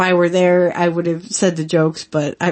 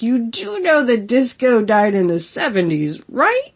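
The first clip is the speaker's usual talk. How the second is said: sassy teasing; 3 semitones higher than usual. Retold or imitated. Ribbing Disco at ringside in a sassy put-on voice. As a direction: tease him with a sassy "right?". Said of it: "it would be like".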